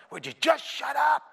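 put-on high, slowed snap at the kids in back; a fed-up parent yelling over the seat; mock road trip parent losing patience; exasperated parent. Parent snapping at kids in car.

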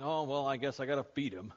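low, reluctant mock grumble; put-on grudging resignation; begrudging. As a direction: give in as if it's a chore. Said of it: "begrudgingly going".

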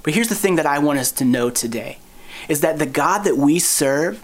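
ordinary delivery

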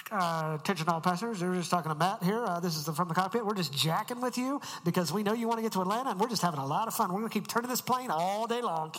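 loud, fast pilot PA voice; crackly cockpit PA announcement. Retold or imitated. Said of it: "they're just going".

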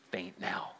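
whispered aside; hushed. Real, not acted. Preacher telling the congregation Jonah is faint, whispered. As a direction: drop to a whisper for the faint man.

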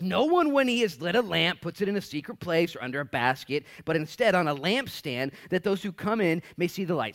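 loud, high scripture proclamation; booming preacher reading; preaching at full force. Acted. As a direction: proclaim the verse to the whole room.

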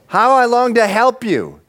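high, impassioned cry; God's urgent longing. Acted. God longs to help Israel, crying out urgently.

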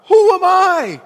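high and loud, pressing on I; anguished loud protest; pleading cry. Acted. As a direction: Moses crying out. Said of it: "emphasis on I".

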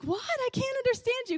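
high-pitched, baffled phone voice; confused retold reply. Daughter answering her yelling dad, baffled. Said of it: "and I'm like".

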